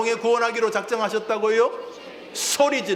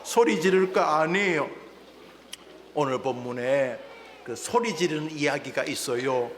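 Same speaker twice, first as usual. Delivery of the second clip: usual speaking voice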